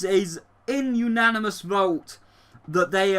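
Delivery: ordinary delivery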